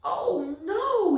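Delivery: high, loud panicked cry; yell. A narrator's reading. Mother yelling when the car won't start. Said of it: "yelled Loretta's mother".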